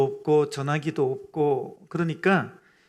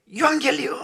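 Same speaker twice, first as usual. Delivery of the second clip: high, raised-voice proclamation; herald's shout; 6 semitones higher than usual. Acted. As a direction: call it out as the gates open. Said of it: "성문을 열자마자 외쳤던 게".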